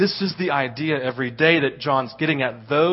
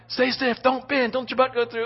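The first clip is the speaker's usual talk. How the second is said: inner panic; 8 semitones higher, 3 dB quieter than usual. Retold or imitated. Inner panic during the fall.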